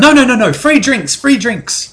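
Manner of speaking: high, gushing half-elf barmaid; grateful barmaid. Acted. Half-elf barmaid thanking her rescuers, high gushing voice. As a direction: gush your thanks in a lifted voice. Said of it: "She walks over to you and goes".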